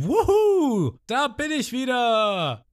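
very high, loud whoop, then slow; joyful cheer. Retold or imitated. Ruffy flying out of the water, very high whoop. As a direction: cheer as he shoots into the air.

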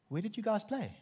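high-pitched feigned confusion; mock puzzled. Preacher playing dumb with Liverpool fans, mock puzzled. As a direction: ask in exaggerated, innocent bafflement.

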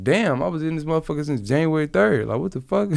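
rising to a squeal of disbelief; replaying his outrage at being forgotten; retold disbelief. Retold outrage at month lost.

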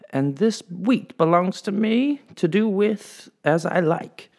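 high, slow, cold claim; haughty lady claiming the wheat. Acted. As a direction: lay claim like a queen. Lady asserting ownership.

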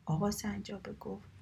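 ordinary delivery

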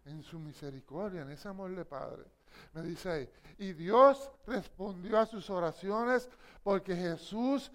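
soft, low and tender; tender hush. Real, not acted. Preacher on the Father's mercy, soft tender hush.

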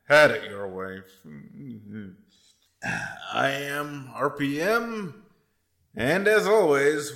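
loud, booming host welcome; big showman welcome; podcast host intro. Acted. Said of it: "the full treatment".

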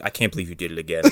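low, soft, quick mock reproach; quiet disappointment. Dad driving out of town, quietly reproachful. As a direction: imitate a muttered scolding, low and soft.

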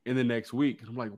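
normal talking voice

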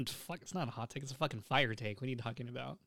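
usual speaking voice

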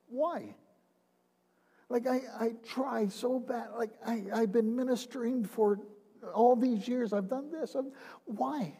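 high, soft, pleading cry to God; heartbroken plea. Retold or imitated. Retired minister pleading with God, heartbroken and high. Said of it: "but heartbroken to God".

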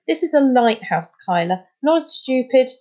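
cross, emphatic scolding; growled. A narrator's reading. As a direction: scold with mild temper. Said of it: "growled Charlie".

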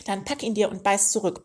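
usual speaking voice

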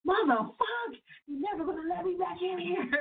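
high, crying-edged mock rant; half-joking high anguished outcry; retold mad outburst. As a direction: replay the rage like a wail. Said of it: "I'm so mad".

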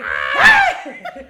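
loud high-pitched shriek like a señora; old-lady scream. Shown as a demonstration. Showing friends the scream they let out when the dog fell, high señora shriek. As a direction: let out one piercing, startled lady's scream. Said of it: "He pegado un grito de señora, así".

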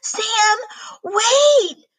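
high, loud alarmed call; shout. Acted. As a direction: call out to stop Sam. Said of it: "I heard Zoe shout".

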